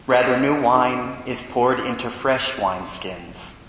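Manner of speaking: formal Gospel proclamation; cathedral echo. Acted. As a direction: reverent liturgical reading. Priest proclaims the Gospel at Mass.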